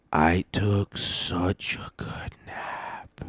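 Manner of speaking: slow, hushed whisper; savouring whisper. Real, not acted. Telling listeners about the great nap, slow savouring whisper. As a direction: whisper it slowly, relishing every word.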